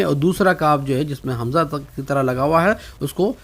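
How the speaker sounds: usual speaking voice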